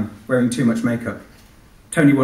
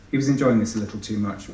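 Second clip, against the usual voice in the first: plain reading voice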